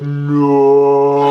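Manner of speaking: loud drawn-out horrified no; dread. Retold or imitated. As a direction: dread as the flush comes. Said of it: "We're like".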